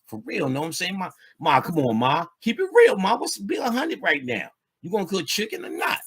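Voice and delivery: mock tough guy badgering mom; street talk to mom. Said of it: "imagine talking to your mama like that".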